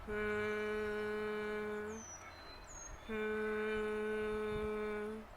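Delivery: long, soft, steady hum; a faint, even engine hum; soft car hum. Shown as a demonstration. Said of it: "They only make a soft".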